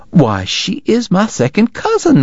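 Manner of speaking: wife discovers a shared cousin, high and thrilled; delighted Southern lady. Acted. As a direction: gasp with delight at the connection. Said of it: "Missus Segar cried".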